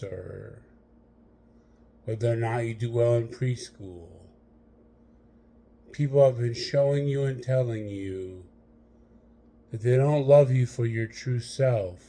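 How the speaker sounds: normal talking voice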